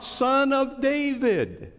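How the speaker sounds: high, slow desperate call; crying out. A narrator's reading. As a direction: cry out over the crowd, pleading. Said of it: "here he's crying out".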